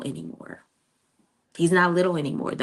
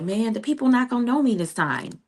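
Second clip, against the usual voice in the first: higher, lightly mimicked complaint; imitated son; 7 semitones higher than usual. Mother retelling her son's worry, higher mimicking voice. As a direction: lightly imitate a kid's protest, pitch lifted.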